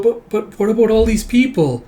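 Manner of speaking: put-on high, stammering child-character plea; worried protest. Child protests about the dead.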